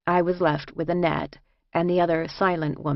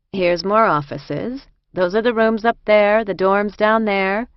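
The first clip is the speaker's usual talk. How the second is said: lightly voiced guide, raised and louder; hint of a busy inmate showing someone around; brisk prison escort; 4 semitones higher, 6 dB louder than usual. A narrator's reading.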